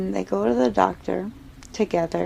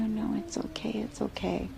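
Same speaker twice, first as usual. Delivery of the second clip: hushed denial; 4 semitones higher, 6 dB quieter than usual. Acted. Wife denying, hushed.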